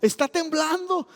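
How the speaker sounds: high, loud, drawn-out cry of alarm; alarmed mother. Retold or imitated. Mother wakes the house during the quake, high alarmed call. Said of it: "mi mamá dice".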